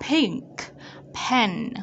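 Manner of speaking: "Pink", pause, "pen"; isolated words "Pink" and "pen", ending k audible; released K ending. Shown as a demonstration. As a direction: pop the final k of "Pink" before "pen". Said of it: "Ở đây có đánh dấu ending sound pink".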